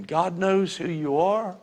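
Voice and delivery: ordinary delivery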